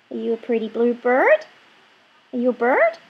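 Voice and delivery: normal talking voice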